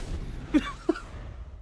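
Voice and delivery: airy wheezing laugh; quiet breathy snicker; breathless laugh. Real, not acted. Laughing at the opponent's loss, wheezy snicker.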